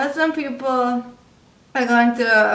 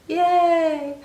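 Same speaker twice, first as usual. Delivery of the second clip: a deadpan, unexcited "Yay"; mock the performer's limp cheer; flat mock yay; 6 semitones higher than usual. Mimicking the performer's dull "Yay". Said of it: "and he's just like".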